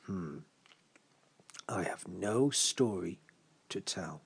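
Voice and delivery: slow, low, resigned admission; quiet, apologetic shrug of a reply; shrugging. Acted. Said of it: "would just shrug his shoulders".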